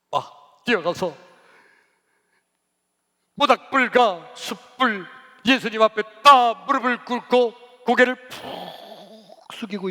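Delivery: loud, high, slowed for drama; impassioned preacher, drawing the words out; full-force preaching. Real, not acted. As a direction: hammer each phrase out from the pulpit.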